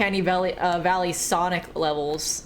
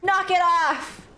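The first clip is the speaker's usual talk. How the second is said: high, drawn-out scolding mother yell; hammed-up cross mum shouting a name; angry mummy voice; 10 semitones higher, much slower than usual. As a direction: call him in like a furious mum telling him off. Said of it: "in a very angry mummy voice".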